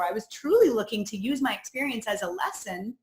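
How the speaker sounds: usual speaking voice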